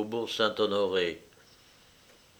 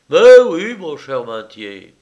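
loud, high exclamation; big, emphatic reply; hearty host; 4 semitones higher, 14 dB louder than usual. A narrator's reading. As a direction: boom out a breezy agreement. Lyra's hearty answer.

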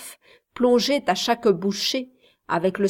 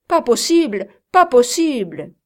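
louder, insistent repeated denial; loud protest; 4 semitones higher, 7 dB louder than usual. A narrator's reading. Kit keeps loudly protesting to his master. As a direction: hammer the protest home. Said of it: "s'écria Kit".

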